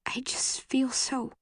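high, breaking whisper; tearful, barely voiced, slow; voice cracking whisper. A narrator's reading. Girl breaking down to him in tears. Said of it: "my voice cracking".

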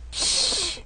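hissing snorkel breath; Darth Vader breathing. Acted. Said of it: "breathing sound just like Darth Vader's".

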